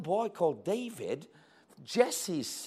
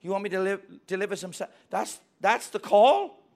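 incredulous; 4 semitones higher, 8 dB louder than usual. Acted. David baffled by sandwich errand.

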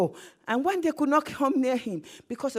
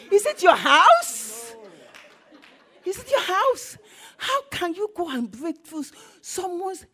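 high-pitched, outraged questioning; indignant disbelief; 5 semitones higher, 5 dB louder, slower than usual. Acted. House owner to men breaking the roof, indignant. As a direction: challenge them as if appalled at their nerve.

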